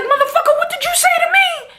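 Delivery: high-pitched mock shout of disbelief; incensed Ghost. Ghost snapping at trash-talking Sax, incensed. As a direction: act out a furious challenge. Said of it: "I love when Ghost give it up like Ghost".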